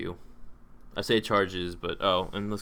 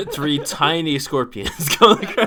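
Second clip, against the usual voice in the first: loud, higher, slowed by laughter; drawn-out amused restatement, cracking up; laughing correction; 8 semitones higher, 9 dB louder, slower than usual. Real, not acted. Game master amends the scorpion description for complaining players, laughing.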